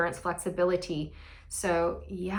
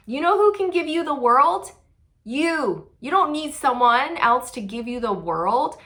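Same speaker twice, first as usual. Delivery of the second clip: ordinary talk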